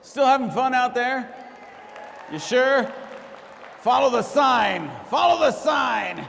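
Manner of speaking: loud, high showman shouting to the room; big, high-energy stage shout; hyped-up lounge host. Acted. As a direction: work the crowd like a club emcee. Lounge host hyping the crowd.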